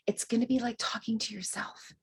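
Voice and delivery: soft confiding whisper. Acted. Medium letting students in on a secret, whispered. Confiding a key point to listeners.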